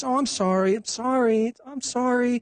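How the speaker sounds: high, climbing, sugary mock apology; fake smiling apology. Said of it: "with a smile on your face".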